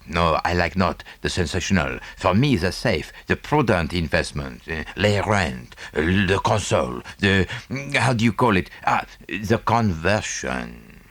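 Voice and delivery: a lightly voiced fussy Belgian detective; Poirot, French accent. Poirot on prudent investments.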